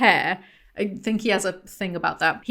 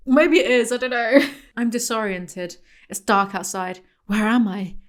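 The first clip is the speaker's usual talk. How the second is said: usual speaking voice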